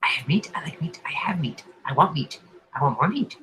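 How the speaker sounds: soft, half-whispered hungry voice; hungry whispering creature. Acted. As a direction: murmur craving meat.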